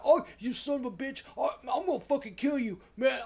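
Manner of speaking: nagespeelde woedende beller, hoger en luider; mad caller. Retold or imitated. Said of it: "this guy's getting mad".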